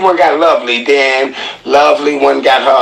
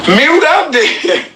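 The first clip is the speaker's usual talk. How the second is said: mock squeaky order; 12 semitones higher, much slower than usual.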